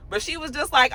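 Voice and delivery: ordinary talk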